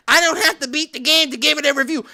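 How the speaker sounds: fast, put-on journalist voice; mock journalist. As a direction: mimic a smug journalist. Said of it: "journalists or whoever that wants to push that".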